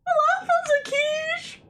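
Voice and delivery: put-on high, squeaky girlish voice with a rising end; cutesy girl voice. Practising a girl voice on stream, high and squeaky. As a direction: chirp it like a tiny cartoon girl. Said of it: "I'm just doing it wrong".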